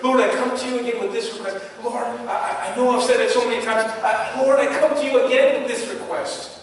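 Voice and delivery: a pleader who cannot stop asking; urgent pleading. Pretend. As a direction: press the request again and again. Repeated prayer, urgent take.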